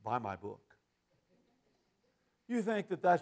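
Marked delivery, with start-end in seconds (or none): 0.0-0.6 low, soft, quick mock of a preacher plugging his book; a hint of a dismissive preacher. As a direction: brush them off like a smug author. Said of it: "Now they say".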